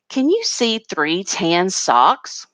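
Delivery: slow, deliberate question with SOCKS lifted; question intonation. Shown as a demonstration. Teacher showing parents how to read a question mark, questioning. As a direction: read it clearly as a question, word by word. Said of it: "Should sound like a question".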